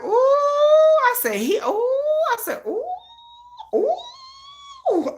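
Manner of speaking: very high, drawn-out ooh; high swooning ooh. Retold or imitated. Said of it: "I was like".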